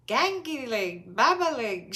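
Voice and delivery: put-on slow, loud babbling toddler; toddler shouting. A narrator's reading. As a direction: shout in baby talk. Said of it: "shouted George".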